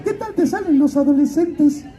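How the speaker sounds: high-pitched godmother voice. Retold or imitated. Godmother asking the actor about his range, in a high put-on voice. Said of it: "Y me dice".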